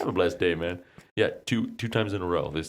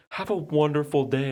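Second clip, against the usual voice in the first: higher, sugary sign-off; sweet caller voice; 10 semitones higher than usual. Retold or imitated. Caller signing off to the wrong number, mock sweet. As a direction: chirp it like a polite stranger. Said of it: "She's like".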